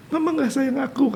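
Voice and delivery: put-on high small-child voice, hurt; child's voice. Grandfather voicing the grandchild telling her mother she is unloved. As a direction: speak like a little girl who feels unloved.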